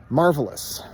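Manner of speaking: usual speaking voice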